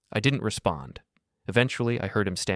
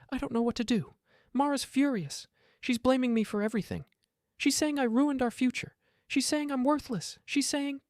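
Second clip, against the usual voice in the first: high, strained voice breaking through sobs; sobbing plea. A narrator's reading. Son begging his father on the porch, sobbing high. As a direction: beg through tears, voice cracking high.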